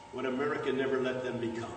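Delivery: usual speaking voice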